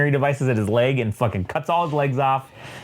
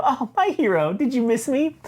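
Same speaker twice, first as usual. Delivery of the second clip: high-pitched coy greeting; mock damsel swoon; 9 semitones higher than usual. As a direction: put on a high, teasing admirer's voice. Mary greets Daredevil coyly, acted.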